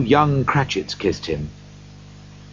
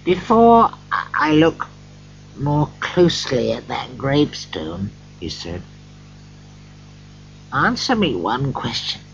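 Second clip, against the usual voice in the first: Scrooge afraid; 5 semitones higher than usual. A narrator's reading. Scrooge, afraid, questions the spirit.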